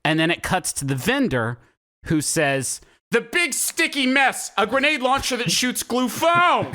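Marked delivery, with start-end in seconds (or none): 3.1-6.7 nervous vendor's pitch. Retold or imitated. Podcaster mimics a film's nervous vendor for friends, high-pitched sales spiel. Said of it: "his inflection goes".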